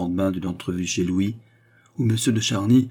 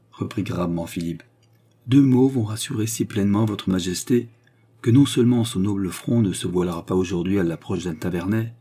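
ordinary delivery

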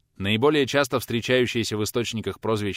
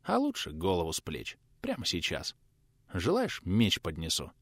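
too tired to care; weary; 3 semitones lower, 5 dB quieter than usual. A narrator's reading. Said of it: "Устало отвечал".

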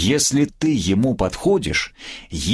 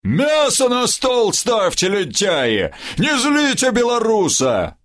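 yelling; 12 semitones higher, slower than usual. Acted. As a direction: roar it from the doorway. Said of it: "с порога заорал внутрь".

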